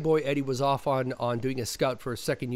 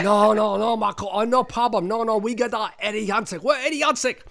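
higher, louder, accented director impression; John Woo; 6 semitones higher, 6 dB louder than usual. Director refusing the stand-in, mock agitated impression. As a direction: imitate a director's broken-English protests. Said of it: "And John went".

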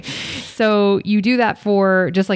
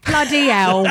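a jokey put-on British "bloody hell", higher; joking British accent, higher; mock British exclamation; 5 semitones higher, slower than usual. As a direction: play the Brit in shock. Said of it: "English accent".

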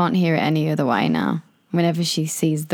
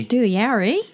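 high Australian questioning lift; Australian accent; 5 semitones higher than usual. Pretend. Quizzing the friend on slang, convincing Australian accent. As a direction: say it Australian with a rising end. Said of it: "That was pretty good".

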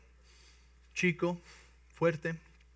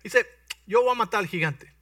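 high, loud, eager volunteering; bursting to fight the giant; young David pleading loudly with the king; eager boy; 6 semitones higher, 7 dB louder than usual. Acted. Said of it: "David tells the king".

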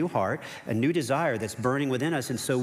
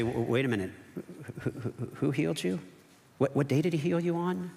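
mock sputtering, stammered questions; flustered questioning; 4 semitones higher, faster than usual. Religious leaders interrogate the healed man, flustered. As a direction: stumble over the start of each question.

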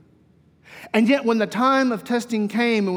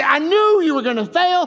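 higher, louder mock contempt; mock belittling; 8 semitones higher, 4 dB louder than usual. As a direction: sneer at the failure. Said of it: "He didn't look at him and say".

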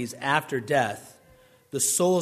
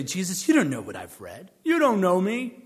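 mock offended critic; 5 dB louder than usual. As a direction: play a huffy tone policeman taking offence. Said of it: "They would say".

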